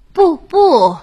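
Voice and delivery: high, loud "不，不！" from the hag; loud cry. A narrator's reading. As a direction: shout to stop her daughter. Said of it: "老妖婆大声叫道". (老妖婆大声喊不)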